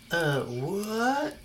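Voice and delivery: put-on slack-jawed, baffled girl voice; lightly voiced stunned disbelief; dumbfounded. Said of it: "my dumbfounded Stephanie impression".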